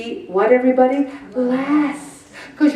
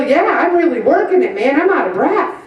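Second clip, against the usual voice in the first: higher, quick, pumped-up boast, imitated; proud exerciser; 4 semitones higher, faster than usual. Imitating people who like cardio, pleased and pumped. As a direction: relish the effort with upbeat drive. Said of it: "they feel".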